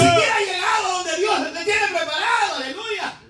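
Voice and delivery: high-pitched impassioned pulpit cry; fervent preaching. Real, not acted. Preacher pressing a believer toward what God prepared, fervent preaching. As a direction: exhort them at full force.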